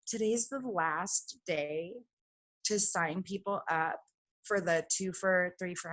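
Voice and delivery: slow, hushed thinking aloud; quiet musing to herself. Retold or imitated. Alone in the bathroom, counting deals, slow and hushed. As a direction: drift through it slowly, half to yourself.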